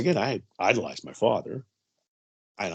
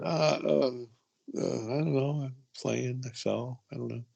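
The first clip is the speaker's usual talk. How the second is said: put-on a frightened boy trailing off softer; a nervous little boy caught lying; scared stammer; 3 semitones higher, 6 dB quieter than usual. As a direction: stammer out excuses, fading to nothing.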